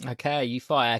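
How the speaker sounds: ordinary talk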